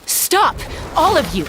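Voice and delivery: high, sharp shout to stop everyone; commanding shout. Acted. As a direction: freeze the whole crowd with one cry. Halting the fight.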